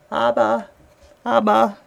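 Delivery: put-on high little-child voice calling Abba; a small child looking up, calling to its father; child calling daddy. Small child calling up to daddy, high child voice. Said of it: "still looking up at Daddy and saying".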